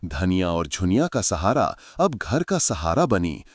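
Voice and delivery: ordinary delivery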